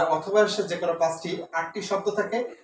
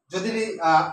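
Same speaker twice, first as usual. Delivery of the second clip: ordinary talk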